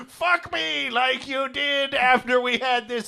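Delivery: lightly voiced scheming woman; evil genius voice. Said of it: "your female voice is like an evil genius voice".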